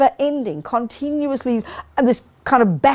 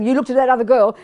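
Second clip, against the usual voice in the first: ordinary talk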